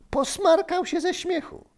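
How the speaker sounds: very high, as if near laughter; amused telling. A narrator's reading. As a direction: pitch it way up with amusement. Narrator recounts a laughing fit. (wysoko, z rozbawieniem)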